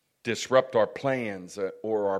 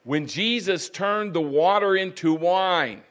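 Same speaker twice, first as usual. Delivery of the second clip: loud, raised-pitch sermon delivery; forceful preaching; 7 semitones higher, 11 dB louder than usual. Real, not acted. Preacher telling how servants did the labor, forceful preaching. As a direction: proclaim it with rising force.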